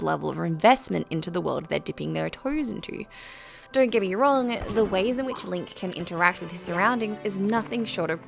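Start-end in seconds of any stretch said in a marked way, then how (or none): none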